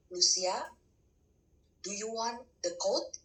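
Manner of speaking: a lightly voiced voice of God, slow and calm; God's voice. God calls to Lucia while she holds a bottle, lightly voiced and slow. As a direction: call her name, then ask slowly and gently. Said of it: "saya dengar suara jelas sekali".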